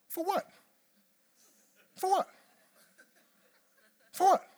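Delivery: wife demanding what for; wife's retort. Retold or imitated. Wife to husband, demanding what he's sorry for. Wife asks back, rising.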